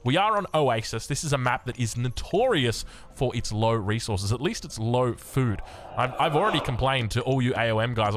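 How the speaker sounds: normal talking voice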